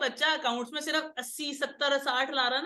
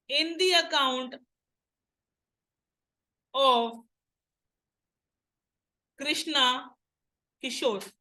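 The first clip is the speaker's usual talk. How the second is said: slow dictation with long pauses between phrases; measured word-by-word classroom dictation; dictating to class; much slower than usual. Shown as a demonstration. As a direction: read it out piece by piece for students to write. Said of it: "अगला लिखो".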